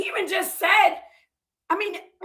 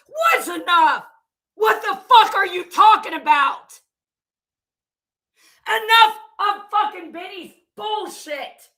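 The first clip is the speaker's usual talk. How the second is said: furious shout; 4 semitones higher, 5 dB louder than usual. Real, not acted. Answering a commenter who turned on her, shouting furiously. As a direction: demand an answer, yelling.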